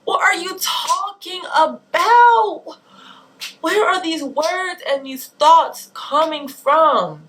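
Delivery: high, very slow, stretched-out question; hammed-up, high-pitched bafflement; incredulous outburst. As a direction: draw each word out in disbelief. Baffled at his screaming in street.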